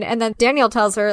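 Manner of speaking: ordinary talk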